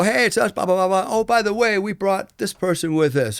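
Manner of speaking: high, bright mock greeting from the crew; lightly imitating cheerful English visitors; chirpy film crew.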